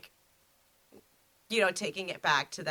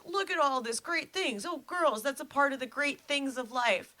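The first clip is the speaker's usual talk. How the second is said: excited; 7 semitones higher than usual. Retold or imitated. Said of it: "he's like".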